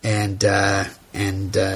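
ordinary talk

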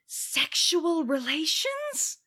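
high, slow, mock-scandalised. The room hearing Aya's alibi, mock-scandalised and slow. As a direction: drag it out, pretending to be shocked. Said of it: "And everyone's like".

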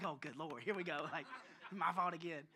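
quiet retold worry under the breath; muted dread. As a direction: think aloud, resigned to being blamed. Dreading inner thought, acted out.

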